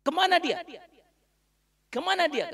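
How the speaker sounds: high, loud urgent question; urgent asking. Acted. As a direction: press hard, needing to know. Abu Bakar asked where Prophet is. (pengemis menanyakan Nabi dengan suara tinggi dan keras)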